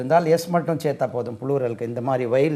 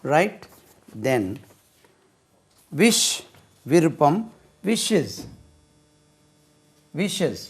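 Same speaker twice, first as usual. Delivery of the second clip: ordinary delivery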